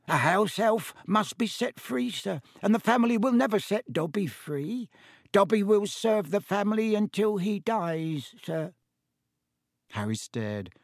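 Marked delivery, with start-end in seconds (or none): 0.1-8.7 high, squeaky little servant; Dobby, elf voice. A narrator's reading. Elf excuses punishing himself, high-pitched.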